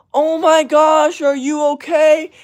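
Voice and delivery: very high, loud, drawn-out mock concern; overdramatic fussing parent. Parent fussing over an unhurt kid, exaggerated mock concern. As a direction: gush with hammed-up alarm, slow and high.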